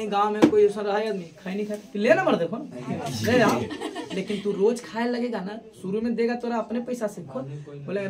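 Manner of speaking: ordinary talk, nothing marked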